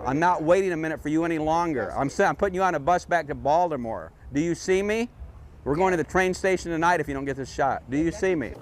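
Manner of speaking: furious director shouting at his actor; angry yelling. Real, not acted. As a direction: bawl out the late actor.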